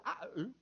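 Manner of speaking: "I, ooh" for "I love you", consonants missing; a child's vowels-only "I, ooh"; childhood speech impediment. Retold or imitated. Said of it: "it wasn't "I love you," it was".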